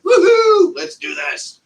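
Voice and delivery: high, loud whoop of excitement; gleeful, high-pitched voicing of an eager seedling; excited cheer. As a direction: whoop it like a seed raring to go. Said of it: "getting all excited".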